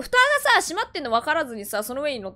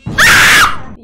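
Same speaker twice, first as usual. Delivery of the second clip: startled scream; 14 dB louder than usual. Real, not acted. Horror jump scare.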